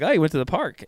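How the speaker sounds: Pennsylvania lilt, "park" sounding like a question; mirroring "you went to the park" with a Pennsylvania lift; Pennsylvania rising tune. Shown as a demonstration. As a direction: let the sentence go up at the end, Pennsylvania style. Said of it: "everything sounds like a question".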